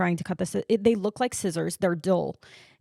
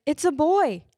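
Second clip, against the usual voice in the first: higher, louder proclaiming; retold announcement; 6 semitones higher, 5 dB louder than usual. Staff telling new parents the sex, retold loud announcement. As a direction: announce it loudly, pitch jumping up.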